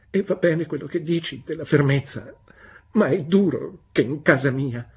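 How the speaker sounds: high, loud, tearful complaint; tearful protest. A narrator's reading. Young wife protesting to her husband, tearful and high. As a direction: plead through tears.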